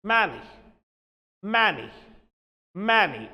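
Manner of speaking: MANY said with an open bleating a; MANY, first vowel wide open as in man; short open vowel. Shown as a demonstration. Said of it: "when we talk of many, then we have to have a sound".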